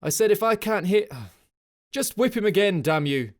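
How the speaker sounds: loud, high furious yell over the gale; angry shout. A narrator's reading. Officer yelling at sergeant over the storm, angry. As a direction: bellow it in frustration.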